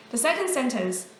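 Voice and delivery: ordinary talk, nothing marked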